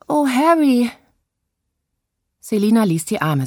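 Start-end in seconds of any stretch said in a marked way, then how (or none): 0.1-0.9 high, loud stagey cry, stress on Harry; theatrical lament. Acted. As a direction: overplay the heartbroken wife. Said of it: "rief Selina theatralisch".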